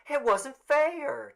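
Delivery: high, slow, pleading woman's voice; Tessie protesting. Acted. Tessie to the officials, high and slow in protest.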